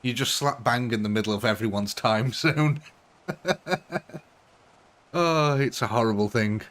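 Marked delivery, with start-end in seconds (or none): 3.3-4.2 laughing. Real, not acted. Laughs about time zones.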